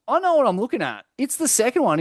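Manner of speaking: high, quick know-it-all insistence; mock confident Rasta. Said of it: "I'm like".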